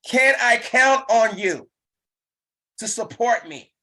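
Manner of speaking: high, loud, slow plea; eager ask. Shown as a demonstration. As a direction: lift the ask with bright hope. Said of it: "demo of the ask".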